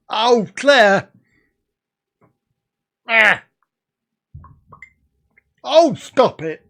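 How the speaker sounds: loud mock-pained yelp; real loud outcry. Real, not acted. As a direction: cry out as if teased. Sees tempting food photo.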